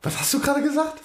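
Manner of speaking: high, disbelieving question; incredulous. Real, not acted.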